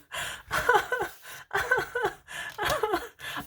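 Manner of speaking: long, high giggle; helpless laughter in high bursts; giggling fit. Real, not acted. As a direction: crack up at a funny memory. Laughs at cat comments.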